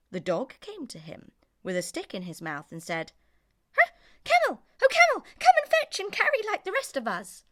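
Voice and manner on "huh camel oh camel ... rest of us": very high, loud excited dog voice; dog pleading with camel, high and loud; yappy dog. Acted. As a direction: bark it out like an eager little dog.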